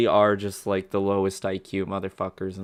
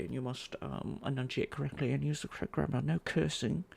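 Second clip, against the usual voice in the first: higher, softer, fussily proper voice; mock prissy scold; 4 semitones higher, 4 dB quieter than usual. Streamer parodying people who forbid cursing, prissy mock-refined scolding. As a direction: play the prim etiquette enforcer, hesitating delicately.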